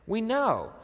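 high, emphatic mock disbelief; scoffing mourners. Mourners scoff at Jesus over the dead girl, imitated incredulity. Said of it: "They are laughing at him because they knew".

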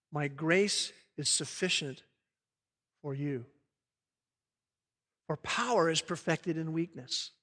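soft, low, unhurried reassurance; hushed divine answer. Acted. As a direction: speak God's comfort quietly, with pauses. Preacher voices God's answer to Paul.

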